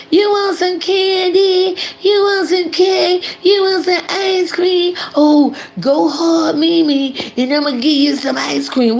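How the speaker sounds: high, slow, sugary lure like a boogeyman; creepy candy-offering voice. Retold or imitated. Mocking a creator luring twins, high creepy coaxing voice. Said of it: "You sound like the boogeyman".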